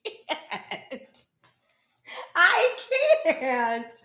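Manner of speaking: high laughing gasps, breath catching; can't stop laughing. Real, not acted. Reader cracking up at the spider card, laughing. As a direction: lose it completely.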